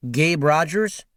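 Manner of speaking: plain reading voice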